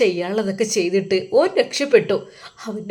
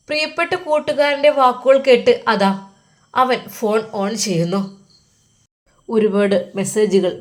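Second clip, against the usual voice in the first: ordinary delivery, nothing marked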